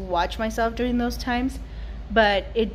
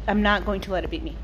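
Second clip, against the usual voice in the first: ordinary talk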